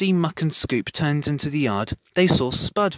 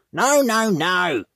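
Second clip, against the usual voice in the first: louder, higher cry of no; 5 semitones higher, 7 dB louder, slower than usual. A narrator's reading. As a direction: protest loudly, higher. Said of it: "cried Spud".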